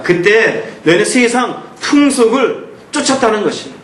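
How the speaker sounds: normal talking voice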